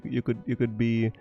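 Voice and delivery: ordinary talk, nothing marked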